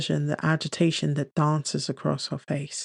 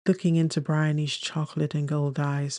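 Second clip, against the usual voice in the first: ordinary delivery, nothing marked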